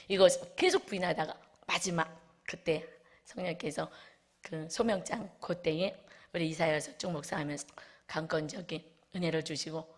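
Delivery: normal talking voice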